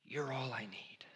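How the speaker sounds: barely voiced surrender; whispered devotion. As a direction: hush it like a confession. Close of Moses' prayer.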